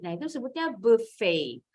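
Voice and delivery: ordinary talk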